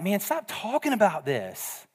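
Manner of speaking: a hammed-up gripe from the left-out crowd; exasperated Gentiles. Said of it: "They'd have been like".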